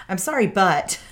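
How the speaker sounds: hammed-up defensive non-apology. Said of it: "instantly get defensive and be like".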